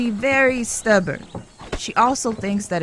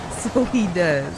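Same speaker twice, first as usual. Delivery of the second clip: amused; slower than usual. Acted. Amused reply to his joke.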